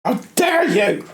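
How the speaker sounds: high, slow indignant; high, drawn-out how dare you; mock-theatrical indignation. Real, not acted. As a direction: deliver it like a wronged aristocrat. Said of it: "It still makes me angry".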